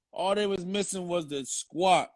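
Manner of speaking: "All they was missing was the squat" drawn out slowly for effect; slow it down and land "squat" as the joke; slow punchline repeat. Shown as a demonstration.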